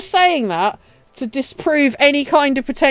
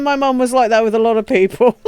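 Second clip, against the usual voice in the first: normal talking voice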